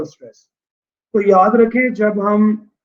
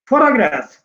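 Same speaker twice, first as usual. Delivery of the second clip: Photograph with the first syllable फो stressed; 4 semitones higher, much faster than usual. Shown as a demonstration. Teacher showing students which syllable is stressed, first-syllable stress on photograph. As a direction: lean on the opening फो of photograph, higher-pitched. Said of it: "syllable number one है".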